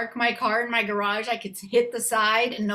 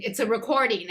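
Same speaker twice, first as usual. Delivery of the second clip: usual speaking voice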